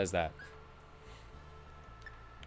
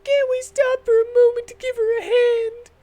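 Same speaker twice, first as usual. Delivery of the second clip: high, pleading companion; high earnest girl; high party girl voice; 11 dB louder than usual. Acted. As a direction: ask it like an eager young heroine.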